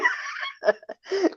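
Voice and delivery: high-pitched giggle; amused laugh. Real, not acted. Speaker laughing after acting out her brain overload, amused giggle. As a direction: laugh at your own flustered act.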